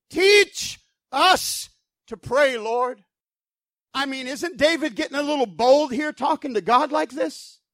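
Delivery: ordinary talk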